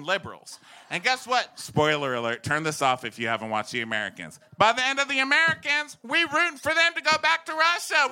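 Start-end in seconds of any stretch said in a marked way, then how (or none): 4.6-8.1 high, raised-voice insistence; worked-up comic rant. Acted. Comic defending Russia through a TV show, worked-up rant. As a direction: argue it at a comic fever pitch.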